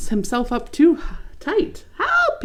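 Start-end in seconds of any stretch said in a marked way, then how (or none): 2.0-2.4 high, louder mock cry for help. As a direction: yelp like a tiny leaf caught tight. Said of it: "he cries".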